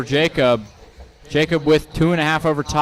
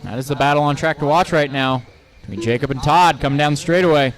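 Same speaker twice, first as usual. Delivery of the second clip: live race call, quick and lively; motorsport commentator; 2 semitones higher than usual. Real, not acted.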